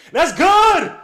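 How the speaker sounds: high, loud, slow call of the shot; excited shout. Real, not acted. Calling his team's three for the stream, excited shout. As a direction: stretch the call out, thrilled.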